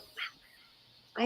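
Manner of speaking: barely voiced, hushed wow; awed whisper. Real, not acted. Donor still moved by families she helped, awed whisper. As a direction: breathe it out in quiet amazement.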